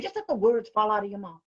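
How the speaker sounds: ordinary delivery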